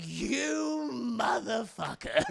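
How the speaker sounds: strained, stretched-out fury; Joker pissed. Retold or imitated. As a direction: draw it out in mock rage. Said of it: "He is so pissed".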